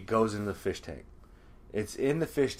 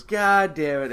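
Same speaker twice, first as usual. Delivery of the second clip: loud, raised mock frustration; higher, louder retold outburst; exasperated curse; 4 semitones higher, 7 dB louder, slower than usual. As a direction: act out a fed-up crowd. Crowd's collective dismay retold.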